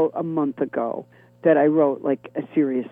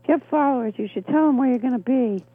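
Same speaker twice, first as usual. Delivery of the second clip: higher-pitched imitation of a manager; manager's female voice; 7 semitones higher than usual. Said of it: "I do this, you know, my female voice".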